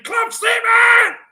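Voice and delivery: drawn-out, high triumphant shout; real roar of jubilation; ecstatic yell. Real, not acted. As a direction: bellow it slowly as the climax. Repeated announcement peaks in celebration.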